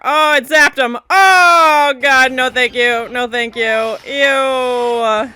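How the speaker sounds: horrified, drawn-out squeamish outburst; real grossed-out alarm. Real, not acted. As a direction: recoil from the spider, loudly refusing. Spider demon attacks in the show.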